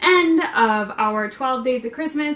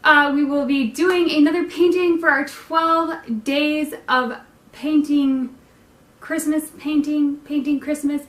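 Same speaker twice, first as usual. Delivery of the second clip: higher, louder announcer read; broadcaster voice; 6 semitones higher, 5 dB louder than usual. Shown as a demonstration. Host announcing the series in broadcaster voice. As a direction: sell it like a TV presenter. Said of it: "broadcaster voice".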